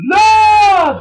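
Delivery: long, high, loud hype shout; hyped-up shout. Pretend. Hyping his favorite wrestlers.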